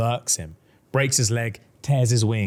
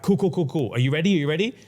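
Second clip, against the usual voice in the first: higher, quick eager checks; eager hype; 6 semitones higher, faster than usual. Retold or imitated. Amryn hyping Nesta before the spell, higher eager voice. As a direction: pump her up to start. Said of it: "And Amryn's like".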